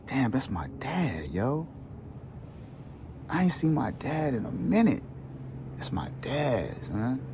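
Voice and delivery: hushed, slow, near tears; emotional whisper. Retold or imitated. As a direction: whisper it to yourself, overwhelmed. Overwhelmed on seeing his father.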